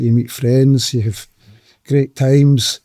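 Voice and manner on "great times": ordinary talk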